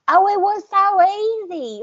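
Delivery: put-on high, slow, drawn-out Southern belle accent. Host mimicking a Muppet reporter in a Southern accent. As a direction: pitch it way up and stretch it in a syrupy Southern accent. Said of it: "when they do Southern accents".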